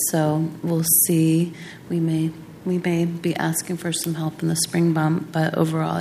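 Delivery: ordinary talk, nothing marked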